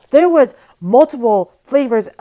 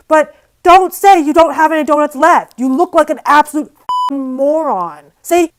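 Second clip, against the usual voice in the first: loud, higher, exasperated lecture; angry scolding; 5 semitones higher, 5 dB louder than usual. Retold or imitated. Scolding the absent cashier for lying, loud and scornful. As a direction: let her have it, scornful.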